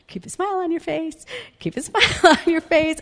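high, strained self-coaching with a laugh; forced cheerfulness. Retold or imitated. Forcing composure over disappointment.

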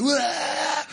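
very high drawn-out mock yell; comic imitation of wild yelling; imitated big-guy yell. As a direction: holler like a fired-up crowd. Imitating big island guys yelling.

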